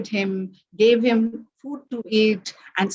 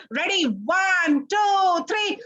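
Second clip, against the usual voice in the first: rising, excited countdown; building countdown; 8 semitones higher, much slower than usual. A narrator's reading. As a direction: count up to the big moment.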